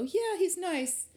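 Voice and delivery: high, drawn-out mock approval; slow, high-pitched reluctant compliment; gossipy faint praise. Quoting small-town talk about someone.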